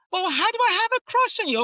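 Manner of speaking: high, loud, fast incredulous cry; flustered disbelief at her own crush; bewildered disbelief. Retold or imitated. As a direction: blurt it out in total confusion.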